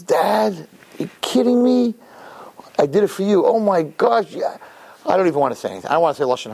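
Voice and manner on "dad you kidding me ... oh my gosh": higher-pitched exasperated son; incredulous protest. Acted. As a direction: plead with Dad in mock disbelief. Son protests to his father.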